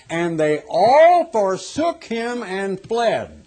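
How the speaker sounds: slow, drawn-out Bible quote; solemn scripture reading. Shown as a demonstration. Preacher slowly reads the Bible's verdict on the disciples.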